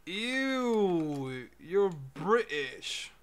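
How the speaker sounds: loud, drawn-out mocking "ew"; mock disgust. Streamer teases Millie for being British, mock disgust. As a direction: jeer it slowly and loudly, starting high.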